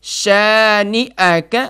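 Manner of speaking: drawn-out melodic recitation; slow Quran recitation. Shown as a demonstration. Teacher leads the student line by line, slow chant. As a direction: stretch each word slowly for the student to repeat. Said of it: "میرے ساتھ پڑھیے".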